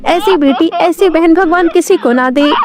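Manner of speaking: high-pitched crying complaint; tearful. Acted. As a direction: plead with mother as a crying younger girl. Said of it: "तभी राधा रोते हुए बोली". (रोते हुए शिकायत)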